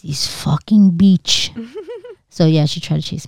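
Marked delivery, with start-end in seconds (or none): 0.0-1.5 slow, accented mock mother's curse; mom's Spanish accent. Imitating her mother cursing the kid, in a slow Puerto Rican accent.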